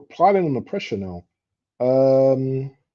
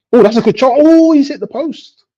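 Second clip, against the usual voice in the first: loud, high-pitched outburst; real high-pitched football excitement; excited match reaction; 11 dB louder than usual. Real, not acted. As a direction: cry out at a near miss. Reacting live to a shot.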